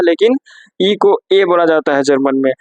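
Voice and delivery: ordinary talk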